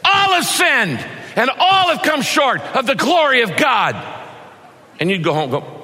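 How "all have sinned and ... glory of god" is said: high, loud preacher's yell, demonstrating anger; angry shout. Shown as a demonstration. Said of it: "I could shout it to you in anger".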